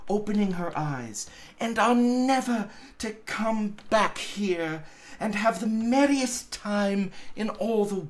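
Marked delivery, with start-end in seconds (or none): 1.6-8.1 put-on little girl voice. A narrator's reading. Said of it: "said the child".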